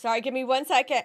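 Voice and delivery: ordinary talk, nothing marked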